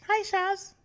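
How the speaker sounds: put-on very high, loud accented mom greeting; mock mom voice, high and piercingly loud; imitating friend's mom. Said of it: "Yo's mom be like".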